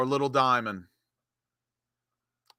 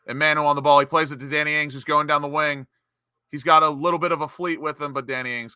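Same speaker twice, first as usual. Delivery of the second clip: higher, quickening match commentary; live play-by-play; 6 semitones higher, 5 dB louder, faster than usual. Real, not acted. Commentator following Manu's pass to Danny Ings, raised play-by-play. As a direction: follow the ball down the wing in a raised voice.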